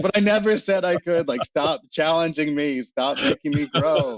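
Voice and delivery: high-pitched exasperated plea through amusement; mock protest. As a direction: protest up high, half laughing. Retelling her flustered protest, laughing.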